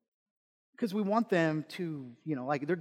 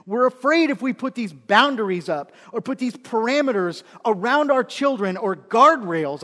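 ordinary talk, nothing marked

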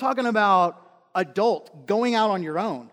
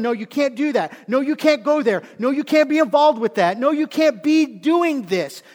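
raised, emphatic voice laying down the law; louder and higher, each refusal driven home; firm parental refusal; 7 semitones higher, 7 dB louder than usual. Acted. Parent telling children no, firm and loud.

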